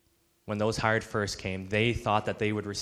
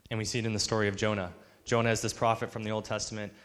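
ordinary talk

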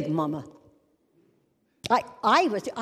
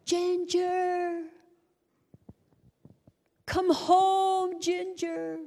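crowd calling out; 9 semitones higher, much slower than usual. Retold or imitated. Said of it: "hundreds of people began".

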